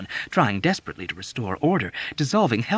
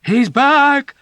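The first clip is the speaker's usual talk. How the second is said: high loud panicked cry; terrified echo; 12 dB louder than usual. A narrator's reading. Feverish patient echoing the scream in terror. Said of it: "echoed in automatic terror".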